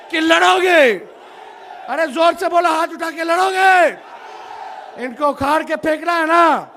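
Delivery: loud, high call to the crowd; booming political rally speech. Real, not acted. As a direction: rouse the crowd, demand answers.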